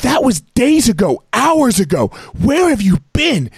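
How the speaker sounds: very high, frantic mock yelling; shrill retold tirade, stretching the final question; imitated screaming wife. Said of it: "just starts screaming at us".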